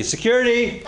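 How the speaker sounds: high, sharp call for guards; shouted call. Acted. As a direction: yell for security. Calling for security.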